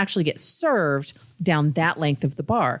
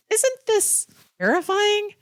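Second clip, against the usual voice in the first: much higher, slow, stretched question; mock horrified; slower than usual. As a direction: gasp it out in exaggerated horror. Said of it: "And I said".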